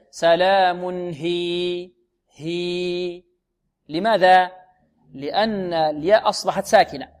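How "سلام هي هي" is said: very slow "سلام هي", ending on long level "hiii"; lengthened stop. Shown as a demonstration. Teacher gives the correct stop, long vowel. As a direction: hold the vowel of "هي" steadily to the stop. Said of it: "بل".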